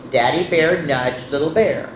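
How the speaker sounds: ordinary delivery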